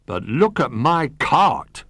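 high, slow wail of protest; dismayed cry. A narrator's reading. Zebra sees the damaged cart, dismayed cry. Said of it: "cried Zebra".

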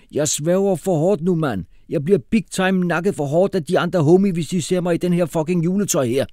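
high, loud slangy complaint; hot-headed street youth. Acted. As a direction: moan like a mortified street tough.

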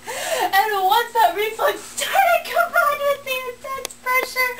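reads on while laughing helplessly. Real, not acted. Reader cracking up over story.

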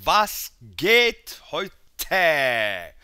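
high, loud, stretched-out hello; drawn-out high shout of a welcome; hyped greeting. Real, not acted. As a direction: call out to the viewers like a host hyping the show. Channel intro greeting viewers.

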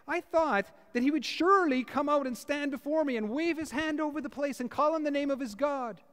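high, indignant complaint; angry Naaman. Retold or imitated. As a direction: voice Naaman grumbling that he was snubbed. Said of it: "went away angry and said".

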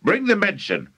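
high, commanding call; urgent yell. A narrator's reading. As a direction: order him along excitedly. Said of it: "Mister Crankie yelled".

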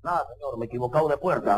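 low, monotone little apology; meek suitor. Acted. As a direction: back down meekly before the fierce father. Timid suitor backs away from father.

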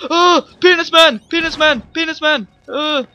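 high, loud delighted shouting; excited yelling. Real, not acted. Gamer spotting the creepy naked man, yells it out loud. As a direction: bellow the silly name at the top of the voice. Spots a figure, yells its name.

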